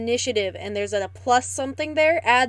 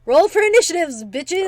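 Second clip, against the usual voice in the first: loud, higher-pitched battle call; rallying shout; 6 semitones higher, 6 dB louder than usual. Real, not acted. Player calling the table to roll for initiative, rowdy shout. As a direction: holler it out to the table.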